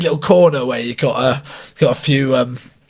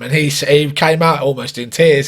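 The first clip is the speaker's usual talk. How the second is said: normal talking voice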